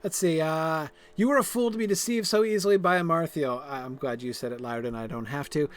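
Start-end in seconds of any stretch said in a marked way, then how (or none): 1.1-3.6 higher, louder, declaiming the line; dramatic quest reading. Acted. Voicing Lyrdon's quest text, stern and declaiming. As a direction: read it like a stern hero. Reads character's line aloud.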